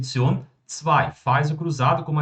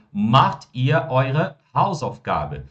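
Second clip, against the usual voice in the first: very slow, every German word laid out clearly; slow model sentence; much slower than usual. Shown as a demonstration. Teacher modelling German for students, very slow and clear. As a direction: spell the sentence out slowly for the class. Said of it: "O que que nós vamos ter".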